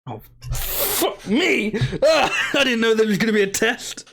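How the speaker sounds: high, laughing yelp of surprise; startled laugh. Real, not acted. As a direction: caught off guard and laughing it off. Surprise quiz question from game.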